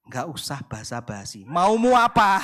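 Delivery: mock-impatient husband cutting to the point; blunt husband reply. Husband answering his wife's love text, low then suddenly demanding.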